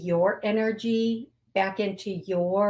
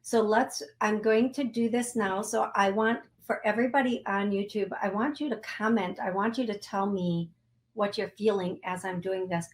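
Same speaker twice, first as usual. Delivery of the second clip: usual speaking voice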